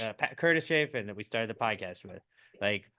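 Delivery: ordinary delivery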